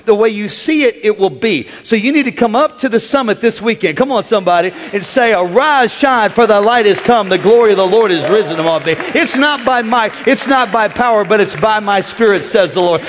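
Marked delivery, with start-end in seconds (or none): 5.3-9.0 loud preached declaration; proclaiming scripture. Acted. Preacher to the congregation, proclaiming scripture loudly. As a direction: declare it like a herald.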